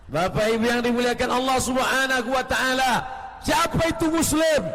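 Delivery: climbs to a loud shouted question; preaching at full force. Acted. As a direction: pushes the question out to the whole crowd. (ceramah berapi-api dengan teriakan)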